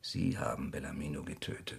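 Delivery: quiet shocked realization; stunned hush. Acted. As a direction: say it hollow, nearly breathless. Realizing the killer's deed.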